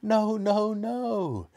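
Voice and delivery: high, desperate repeated protest; crying out. A narrator's reading. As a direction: cry it in rising panic. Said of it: "the other two cried".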